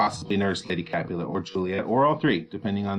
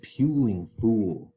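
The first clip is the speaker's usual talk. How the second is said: slow stressed word; much slower than usual. Shown as a demonstration. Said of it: "Puling means crying".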